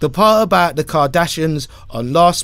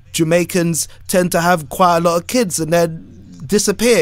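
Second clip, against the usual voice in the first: ordinary talk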